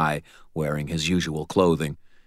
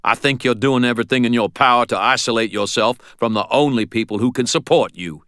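stern mentor; 6 semitones higher, 5 dB louder than usual. A narrator's reading. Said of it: "he said".

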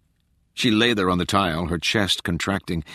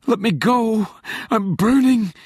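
high-pitched, louder desperate plea; panicked cry; 14 semitones higher, 4 dB louder than usual. A narrator's reading. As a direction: cry out in agony, voice jumping high. Character pleads, burning in pain.

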